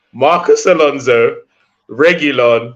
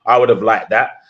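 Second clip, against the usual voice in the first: usual speaking voice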